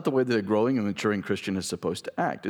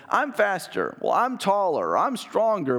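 put-on higher childish bragging; boasting kids; 8 semitones higher, slower than usual. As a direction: toss out playground boasts.

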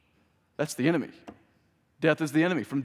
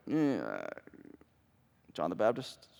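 hesitant answer; 5 semitones lower, 8 dB quieter than usual. Retold or imitated. Voices disciples answering unsurely.

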